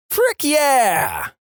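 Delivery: put-on very high-pitched girl's yell; yelled reply. Vodka girl yells back at catchphrase guy, yelling. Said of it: "Then she yells".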